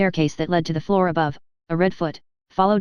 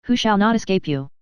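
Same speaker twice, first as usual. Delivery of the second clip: changed voice; 2 semitones higher than usual. A narrator's reading. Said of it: "in a changed voice".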